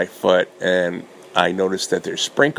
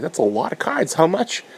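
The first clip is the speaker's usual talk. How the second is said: higher, wary voice; wary surprise; 10 semitones higher than usual. Retold or imitated. Facing bags of cards, asking the price in high surprise. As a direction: ask the price half alarmed. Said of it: "I'm like".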